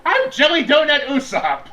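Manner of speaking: high, goofy cartoon voice; silly character voice. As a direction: squeak it out like a cartoon. Jokey character name.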